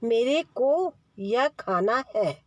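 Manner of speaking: slow, clear मेरे with a proper r; careful correct model. Shown as a demonstration. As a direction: say it slowly for the child to copy. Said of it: "ऐसे बोलो".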